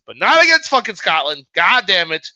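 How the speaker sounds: loud, exasperated curse at his team; frustrated outburst. Real, not acted. Vlogger fuming that Scotland lost to Italy, loud exasperated outburst. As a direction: blurt it out, fed up with Scotland losing. Fan angry at Scotland's loss.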